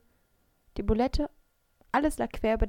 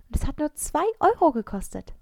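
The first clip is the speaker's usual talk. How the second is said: high and loud with a broad grin; grinning, pleased; 7 semitones higher, 8 dB louder than usual. A narrator's reading. Mara brags about the cheap sandwich, grinning widely. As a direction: brag through a wide grin. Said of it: "Sie grinste breit".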